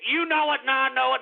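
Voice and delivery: ordinary talk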